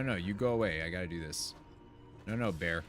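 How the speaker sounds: ordinary talk, nothing marked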